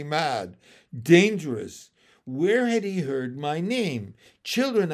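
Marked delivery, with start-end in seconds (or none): 4.4-4.9 loud, raised summons; shout. A narrator's reading. Frog mother shouting to her froglets. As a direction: shout to round up the children. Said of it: "I shouted".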